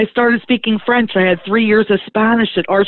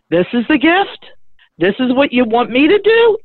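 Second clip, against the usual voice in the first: high, rising disbelieving questions; retold can-you-believe-this protest; incredulous; 6 semitones higher than usual. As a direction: look up and question it in mock disbelief. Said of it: "like, "You gotta be kidding".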